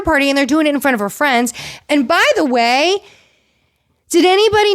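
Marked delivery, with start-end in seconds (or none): none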